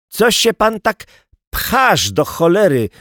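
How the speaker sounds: high, cross telling-off; mildly snappish countrywoman; snarled complaint. A narrator's reading. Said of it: "warknęła na niego gruba wieśniaczka".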